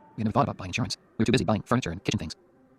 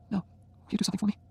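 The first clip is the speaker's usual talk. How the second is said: high, slow, soft request; dying wife asking softly; soft plea; 12 semitones higher, 3 dB quieter than usual. A narrator's reading. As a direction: ask tenderly. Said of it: "Jenny asks gently".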